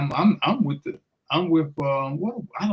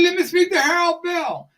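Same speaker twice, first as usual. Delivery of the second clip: Ali's voice. Retold or imitated. Ali repeats, impatient.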